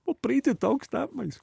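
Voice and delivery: high, bright mock stranger voice; punchline as a friendly passerby; cheery stranger. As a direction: pop it up high and chirpy. Punchline, imitating the fella's question.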